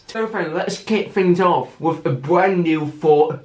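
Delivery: usual speaking voice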